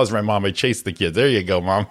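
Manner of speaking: usual speaking voice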